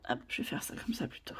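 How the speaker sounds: quick whispered aside; whispering. Real, not acted. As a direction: whisper it to yourself. Whispered aside while crafting.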